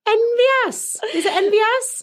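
high, loud Welsh lilt; Welsh accent. Shown as a demonstration. Guessing the name for co-host, slipping into Welsh. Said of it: "I just slip into Welsh".

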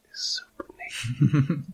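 slow soft whisper. Acted. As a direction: breathe it out slowly. GM whispers aside.

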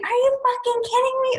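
squealed incredulity, held high throughout; retold scream. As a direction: imitate a shriek of disbelief without full volume. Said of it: "I'm just screaming".

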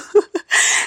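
unvoiced high chuckle; amused laugh after the quote; high breathy laugh. Real, not acted. Laughs after quoting Nicodemus.

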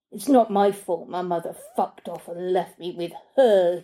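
put-on sullen boy grumbling at the nanny; sulky teenage boy. A narrator's reading.

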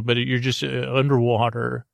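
ordinary delivery

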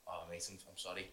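very quiet, low retold apology; sheepish apology. Apologising to a mate stuck in mud, sheepish and hushed. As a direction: imitate yourself apologising under your breath.

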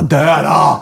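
mock furious outburst; forceful, drawn-out retold cry; retold fury. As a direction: imitate someone raging at a wasp. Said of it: "hennes raseri".

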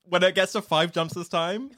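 ordinary delivery

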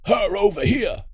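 high, loud shouted command; shouted order. Acted. Masked intruder to captives, shouted order. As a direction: bellow it slowly like a robber in charge.

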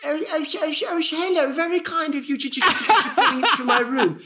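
put-on high, wavering lonely old man on the phone; old man Derek voice. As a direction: play a timid elderly man ordering room service. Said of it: "I'm like".

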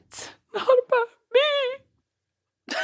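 hammed-up sobbing, very high and drawn out; imitated tearful wail. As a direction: squeak it out slowly through mock tears. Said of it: "burst into tears at the table".

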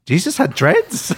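slow, high incredulous question; baffled disbelief. Retold or imitated. Student reacting to picture of Jesus, acted high disbelief.

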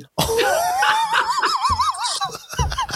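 long, high-pitched real laughing fit; helpless laughter. Real, not acted. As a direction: burst out laughing at your own story. Cracking up at his own story.

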